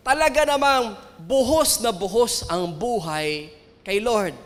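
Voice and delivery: ordinary talk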